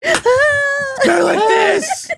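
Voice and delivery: very high, long drawn-out shriek; stretched piercing yell; demonstrated scream. Shown as a demonstration. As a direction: scream it at the top of your range. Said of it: "you can actually scream".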